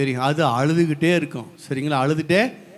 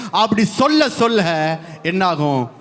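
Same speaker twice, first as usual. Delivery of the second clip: ordinary talk, nothing marked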